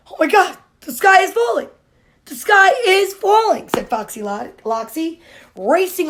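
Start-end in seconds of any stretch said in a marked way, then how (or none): 0.1-3.7 high, fast panicked shout. A narrator's reading. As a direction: blurt it in sudden panic. Fox panics after acorn.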